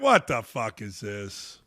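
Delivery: low, soft, slow disbelief; slow, quiet scoff of disbelief; disgusted reaction. Retold or imitated. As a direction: let it drop low in stunned disgust. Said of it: "I would be like".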